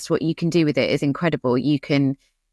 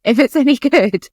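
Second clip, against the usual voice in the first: higher, louder, with a laugh; 9 semitones higher, 5 dB louder than usual. Real, not acted. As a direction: laugh through the words. Laughs while making the point.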